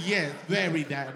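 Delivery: ordinary talk, nothing marked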